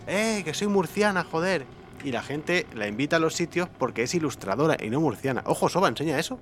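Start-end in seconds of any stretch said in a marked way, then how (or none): 0.1-1.6 mock proud shout. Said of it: "lo va por ahí gritando a los cuatro vientos".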